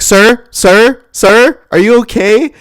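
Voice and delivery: high, loud, slow calling; alarmed lady. Retold or imitated. Lady calling to the dazed donor, high loud alarm. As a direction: imitate a woman urgently checking on someone. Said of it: "this lady comes up to me".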